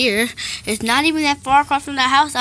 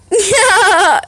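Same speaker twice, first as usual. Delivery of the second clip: crying laugh; 8 semitones higher, 7 dB louder than usual. Acted. Tired laugh.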